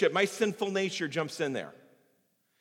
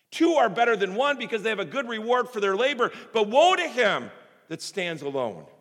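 louder, higher scripture preaching; 5 semitones higher, 6 dB louder than usual. Acted. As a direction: declare each verse like a call. Quotes scripture with force.

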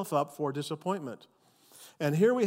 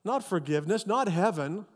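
ordinary talk, nothing marked

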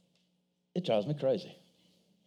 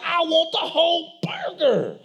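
mom voice; 8 semitones higher than usual. Retold or imitated. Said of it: "She's like".

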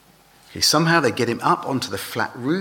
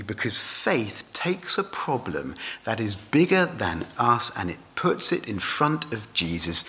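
normal talking voice

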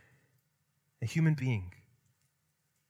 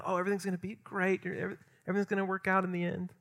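higher, softer, quick platitude; mock glib reassurance; 5 semitones higher, 6 dB quieter, faster than usual. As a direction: imitate someone offering hollow comfort. Mimics glib comforting of persecuted Christians.

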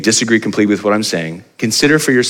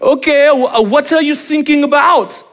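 put-on much higher, German accent, puzzled and half laughing; mock German accent; 3 dB louder, slower than usual. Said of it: "And he goes".

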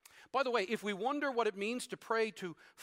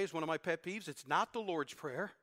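ordinary talk, nothing marked

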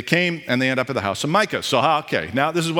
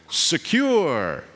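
ominous, drawn-out word; mock-spooky premonition voice. Shown as a demonstration. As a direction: intone it like a foreboding omen. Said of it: "meant to sound like a premonition".

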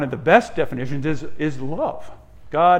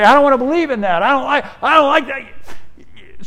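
high, loud, fast mock objection; mock protest; 9 semitones higher, 6 dB louder than usual. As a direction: imitate a stubborn objector, pitched right up. Acting out objectors to the doctrine.

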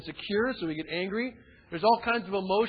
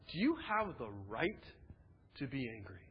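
softer, lower, calm voice of God; gentle question from God; 6 semitones lower, 10 dB quieter than usual. Retold or imitated. God gently questions angry Jonah, soft and calm. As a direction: ask it quietly, like a patient father.

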